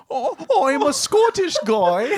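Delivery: high, slow hammed-up Scottish; bad Scottish accent. Hopeless comic auditioning for SNL, bad Scottish accent. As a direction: ham up a terrible Scottish accent. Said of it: "I'm just like".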